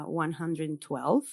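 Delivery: ordinary delivery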